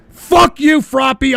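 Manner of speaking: loud, high burst of anger; angry outburst. Real, not acted. Host refusing a listener's request, angry shout. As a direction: lash out with a sudden yell.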